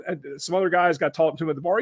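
normal talking voice

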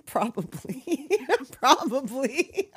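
slow words broken up by real giggles; laughing. Real, not acted. Host giggling over Old Sheila's age, really laughing. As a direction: crack up through the word. Cracking up at co-host's joke.

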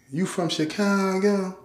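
imitated Memphis country drawl; country Southern accent. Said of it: "That's how she talk".

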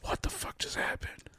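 whispered in shock; whispering to himself after the attack; stunned whisper. Retold or imitated. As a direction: breathe it in a whisper, dazed. Sitting at home, stunned.

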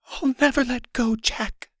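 high, hoarse stage voice. A narrator's reading. Girl overacting the end of Titanic, high hoarse voice. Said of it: "she said hoarsely".

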